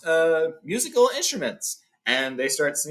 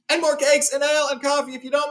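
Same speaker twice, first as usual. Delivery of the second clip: much higher, louder dwarves calling through the door; a high dwarf shout from another room; 13 semitones higher, 4 dB louder than usual. Retold or imitated. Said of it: "called the other dwarves through the door".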